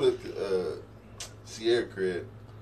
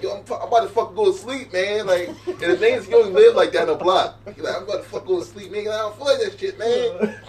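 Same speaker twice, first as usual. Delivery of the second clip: raised, loud mock whine of a friend; lazy friend refusing; 11 semitones higher, 7 dB louder than usual. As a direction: brush him off, he wants to sleep.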